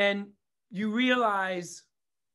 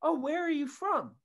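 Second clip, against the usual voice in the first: nice woman asking; 6 semitones higher than usual. Retold or imitated. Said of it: "very nice, very nice question".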